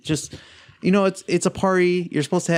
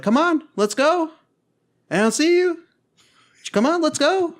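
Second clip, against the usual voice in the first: high, sing-out mock beckoning; retold taunting; 13 semitones higher than usual. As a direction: goad him from afar as you back away. Taunting while walking backwards.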